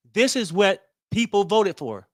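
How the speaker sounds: usual speaking voice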